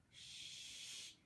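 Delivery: sharp voiceless shush; hissed shush. Acted. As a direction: hiss it for silence. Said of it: "hissed Professor McGonagall".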